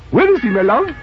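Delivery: put-on high, thin elderly voice; old Scrooge voice. Scrooge begging the charity gentleman, high old voice.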